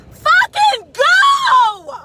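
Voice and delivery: high, loud, drawn-out yell at the traffic; road-rage yell from the driver's seat; furious shout. Real, not acted. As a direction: scream it at the car ahead, long and raw. Yelling at traffic while driving.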